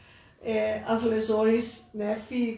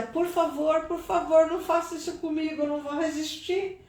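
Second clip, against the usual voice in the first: desperate pleading; 6 semitones higher than usual. Retold or imitated. Mother begging on the phone.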